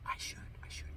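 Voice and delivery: whispered sarcastic aside. Real, not acted. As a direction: whisper it mockingly to yourself.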